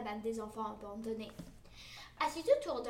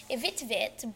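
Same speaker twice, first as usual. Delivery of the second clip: ordinary delivery, nothing marked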